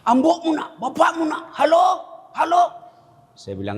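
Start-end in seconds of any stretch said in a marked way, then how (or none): 0.1-2.6 shouting into phone. Retold or imitated. Old man yelling to his son on the phone. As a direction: call out desperately to his son. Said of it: "Sudah teriak".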